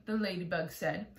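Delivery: plain reading voice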